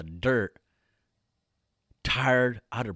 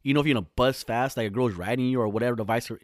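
usual speaking voice

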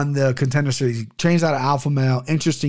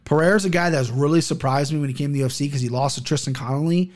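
usual speaking voice

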